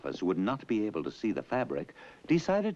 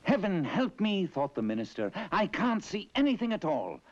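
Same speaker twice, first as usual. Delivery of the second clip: minister's panic; 11 semitones higher than usual. Acted. Minister panics seeing nothing.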